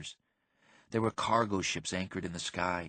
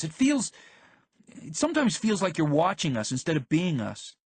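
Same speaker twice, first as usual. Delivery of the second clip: high, loud and upset; heated argument; 10 semitones higher, 7 dB louder than usual. Acted. Violet argues with the narrator in the car, loud and upset. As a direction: pour it all out, hurt and rising.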